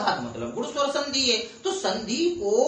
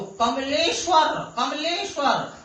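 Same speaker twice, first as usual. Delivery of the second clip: Kamleshwar slow and high, ले stretched out; stress on ले; 6 semitones higher, slower than usual. Shown as a demonstration. Teacher demonstrating stress on the vowel, high drawn-out ले in Kamleshwar. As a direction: punch and lengthen the ले inside Kamleshwar each time. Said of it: "एक ही मात्रा से".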